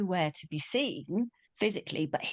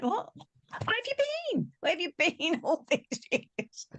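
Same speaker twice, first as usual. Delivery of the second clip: very high, squeaky mock outrage; comic retold reproach breaking into laughter; indignant disbelief; 12 semitones higher than usual. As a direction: demand an explanation in a hammed-up high voice. Acted indignant challenge to guardian.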